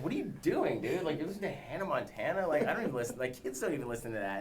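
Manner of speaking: mocking Brad Pitt. Imagined co-star mocking his music, high teasing voice. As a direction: scoff at him like a cool older guy. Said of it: "What if Brad Pitt caught me".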